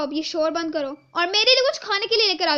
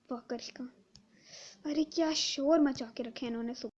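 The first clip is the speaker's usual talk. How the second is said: whisper; 5 semitones lower, 9 dB quieter than usual. Acted. Whispered aside.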